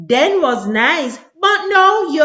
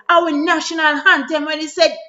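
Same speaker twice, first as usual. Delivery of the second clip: ordinary talk, nothing marked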